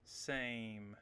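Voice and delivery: same said slowly with a long A; long vowel in same. Shown as a demonstration. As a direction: draw out the vowel in same, low. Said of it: "So this one is long".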